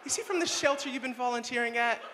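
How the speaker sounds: high, gushing mom voice. Retold or imitated. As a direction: squeal like a fussing suburban mom. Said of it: "and I'm like".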